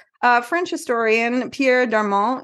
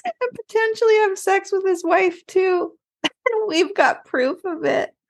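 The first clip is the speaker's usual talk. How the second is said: high-pitched, giggling through the words; real laughing; 6 semitones higher than usual. Real, not acted. Host tells of trials before a jury, laughing helplessly. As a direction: struggle to read on while cracking up.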